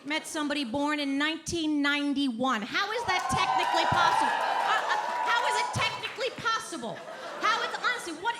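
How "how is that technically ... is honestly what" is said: mock disbelief. Comic outburst at the young audience. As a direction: yell it in outraged confusion. Indignant about young people's ages.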